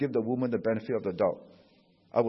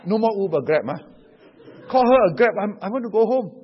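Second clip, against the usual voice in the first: loud, bossy voice, pitched higher; mock wife's orders; 6 semitones higher, 9 dB louder than usual. Wife on the phone ordering husband around, loud and bossy.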